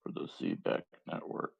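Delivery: soft whispered reading; whispering. Real, not acted. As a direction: whisper it under your breath. Reads crypto blurb unvoiced.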